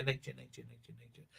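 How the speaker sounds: very soft repeats dying away to a whisper; fading echo. Acted. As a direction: let the word echo off into nothing. Repeats word, fading away to nothing.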